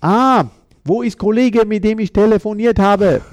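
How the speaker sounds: mocking the club boss in exaggerated simple German; condescending boss. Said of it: "sagt er".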